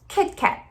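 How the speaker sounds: "Kit Kat" with the T dropped, whispered; silent T. Shown as a demonstration. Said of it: "o T, ele é silencioso".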